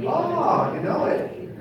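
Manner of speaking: usual speaking voice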